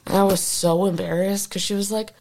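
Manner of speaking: ordinary talk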